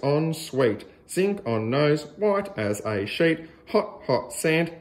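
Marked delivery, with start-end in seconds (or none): none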